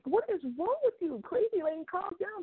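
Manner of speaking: high mock scolding of her own tears; scolding herself. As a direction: tell yourself off, half-amused. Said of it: "I was like".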